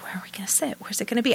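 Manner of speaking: whispered worry to herself; hushed fretting about seats; anxious whisper. Retold or imitated. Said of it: "And so I always am like".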